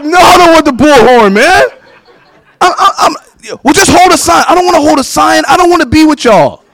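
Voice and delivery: high, loud pleading whine; whiny refusal. Retold or imitated. Tori refusing to hold a sign, high whining protest. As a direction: beg your way out of it.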